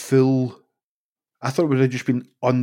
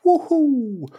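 high whooping woohoo; a jokey high-pitched whoop; mock cheer; 13 semitones higher than usual. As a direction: cheer it like a fan. Cheering along with the story.

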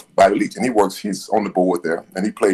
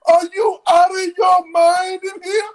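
imitating a high shout; slower than usual. Attendant yelling at Bobby in the shower, high mock shout. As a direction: bellow it in a high put-on voice. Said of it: "He said".

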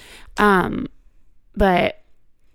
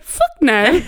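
teasing British-accented imitation; 6 semitones higher, 4 dB louder than usual. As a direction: echo it higher with a British lilt. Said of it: "you sounded British".

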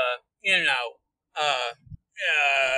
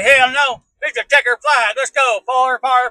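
loud, higher race-day yell; excited holler; 6 semitones higher, 8 dB louder than usual. Retold or imitated. Heartbroken hillbilly retelling how he ignored the warning, excited holler. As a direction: whoop it out like a green flag dropped. Acting out his reckless past decision.

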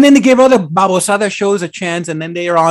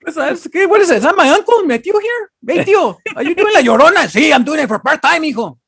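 high, excited voice of a nephew recognizing his uncle; surprised nephew then uncle; 11 semitones higher than usual. Acted. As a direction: squeal it like a kid spotting his tío. Uncle discovered playing La Llorona.

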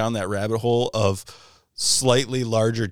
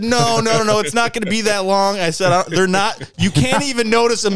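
higher and louder, hammed-up calming; mock reassuring; 11 semitones higher, 5 dB louder than usual. Teller calming down panicking Todd, mock excited. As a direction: play it up as frantic reassurance.

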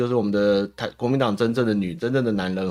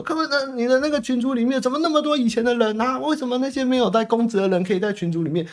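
much higher nagging mock voice; mock scolding councilor; 14 semitones higher than usual. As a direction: parody a hall monitor grilling the mayor. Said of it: "然后再来就骂柯文哲说".

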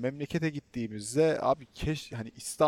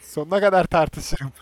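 louder, playful challenge; playful defiance; 5 semitones higher, 8 dB louder than usual. Real, not acted. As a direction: declare it with a grin.

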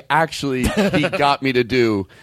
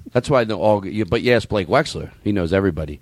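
ordinary talk, nothing marked

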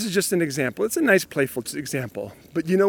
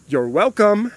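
pointed, sarcastic you're welcome; 5 semitones higher, 3 dB louder, slower than usual. Retold or imitated. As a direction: say thanks with a bite. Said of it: "you and I both know what that "you're welcome" really means".

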